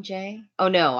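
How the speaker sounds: ordinary talk, nothing marked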